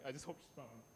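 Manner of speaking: very soft, almost breathless; barely getting the words out for grandma; tearful whisper. Real, not acted. Remembering late grandmother, tearful.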